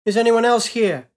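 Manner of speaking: loud, high desperate call. Acted. Pilot calling out into the wreck, loud and desperate. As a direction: shout for anyone out there.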